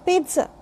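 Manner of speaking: pizza said carefully as PEET-suh; correct pronunciation. Shown as a demonstration. Teacher repeating pizza after the breakdown, PEET-suh. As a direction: put a ts inside pizza. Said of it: "It is".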